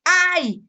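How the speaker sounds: sharp high cry of ouch; a sudden pinched ay; yelp of pain. Retold or imitated. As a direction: Chavo yelps after a pinch. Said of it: "el Chavo dice".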